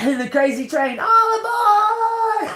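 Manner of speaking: stretched high shouted all aboard; train conductor call. Pretend. Joking to viewers, a wild conductor's all aboard.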